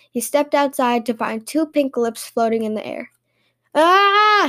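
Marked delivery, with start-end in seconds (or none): 3.8-4.5 high, loud cry of alarm; startled shout. A narrator's reading. Shop owner finds lips floating in the air, startled shout. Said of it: "he shouted".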